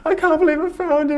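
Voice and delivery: hammed-up tearful squeal; mock bawling. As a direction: act out crying with overwhelmed joy, voice shot way up. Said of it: "bawling my eyes out in the dream".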